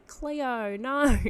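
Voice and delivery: high, whiny Australian mock cry; Aussie whiny accent. Podcaster quoting a viral H2O clip, whiny Aussie impression. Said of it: "that thing that goes around from H two O".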